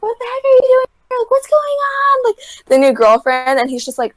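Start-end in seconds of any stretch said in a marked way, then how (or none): 0.0-2.4 high-pitched, fast imitation of a shocked girl. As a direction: squeal it in mock disbelief, voice way up high. Acting out the girl's outraged surprise.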